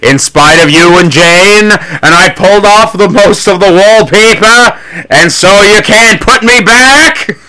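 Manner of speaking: hammed-up building defiance; triumphant madwoman. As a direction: draw out every word, rising steadily. Voices the escaped woman, building.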